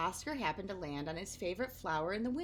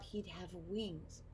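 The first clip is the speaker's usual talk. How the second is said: hushed wonder; 8 dB quieter, much slower than usual. A narrator's reading. Dreamy narration.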